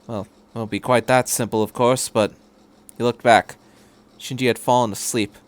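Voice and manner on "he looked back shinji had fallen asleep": plain reading voice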